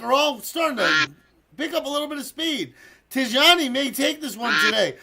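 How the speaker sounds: building to a loud congratulation for the winner; live race commentary. Pretend. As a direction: call the duck race as it unfolds. Calls a duck race live.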